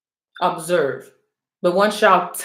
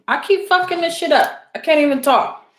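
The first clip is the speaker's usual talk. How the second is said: frustrated; 6 semitones higher than usual. Real, not acted. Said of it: "I'm so aggravated".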